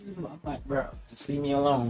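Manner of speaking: ordinary talk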